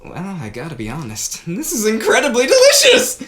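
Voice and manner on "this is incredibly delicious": high, loud rave about the food; excited praise. Acted. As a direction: burst out in delight at the taste. Praising the cooking.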